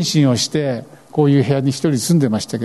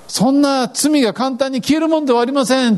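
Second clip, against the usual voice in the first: stubborn refusal; 10 semitones higher than usual. Retold or imitated. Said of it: "って言ったんです".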